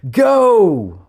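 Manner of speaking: long, high starting shout; shouted command. A narrator's reading. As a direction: bellow the start order across the pool. Sergeant shouts start of test.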